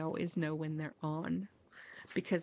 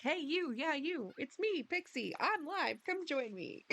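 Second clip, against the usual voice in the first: higher, playful go-live announcement; mock announcer; 6 semitones higher than usual. Imagining her own stream notification, a higher cheery announcement. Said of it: "I'd be like".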